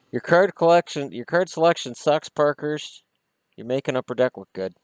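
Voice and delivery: high, loud mock scolding of a card brand; mock rant. Real, not acted. Fan scolding Parkhurst over its card selection, high and loud. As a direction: tell the card company off, half joking.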